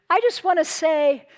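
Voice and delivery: ordinary talk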